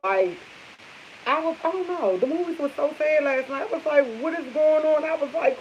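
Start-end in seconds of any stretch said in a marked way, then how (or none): 4.2-5.0 higher-pitched exasperated self-quote; mock baffled at yourself, pitched up; retold dismay. Asking herself during a sad movie, retold dismay.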